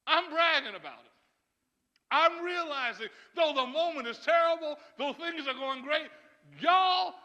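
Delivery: rising high and loud to a cry on y'all; climbing to a shout; building preacher shout. Real, not acted. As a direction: preach it up to full force.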